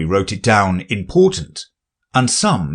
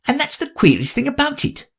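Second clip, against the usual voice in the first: high respectful rabbit voice. Acted. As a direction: politely correct the king, high.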